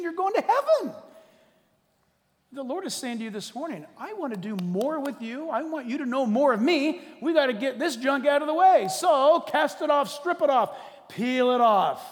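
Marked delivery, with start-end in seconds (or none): 0.0-0.9 high, loud, laughing cry; joyful shout with a laugh. Real, not acted. Preacher exclaiming joyfully. 4.0-12.0 building, loud, higher exhortation; preaching the Lord's voice. Acted. Preacher voicing the Lord's call to cast off junk, building to a shout.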